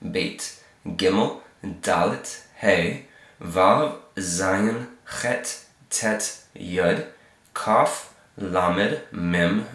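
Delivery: letter-by-letter recitation of the Hebrew alphabet; reciting the alphabet. Shown as a demonstration. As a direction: recite each letter name clearly for learners. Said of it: "So here we go, right to left".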